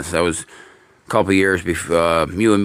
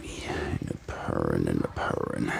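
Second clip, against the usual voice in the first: whisper; 9 dB quieter than usual. Real, not acted. Murmuring to the purring cat.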